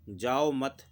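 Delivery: "जाओ मत" as one phrase, then a break; pause after मत. Shown as a demonstration. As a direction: run जाओ into मत and stop. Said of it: "मैंने तो लगाया था कॉमा यहाँ".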